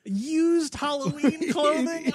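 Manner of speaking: high, drawn-out disbelief; incredulous. Real, not acted. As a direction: stretch it out, can't believe it. Disbelief at used Halloween clothes.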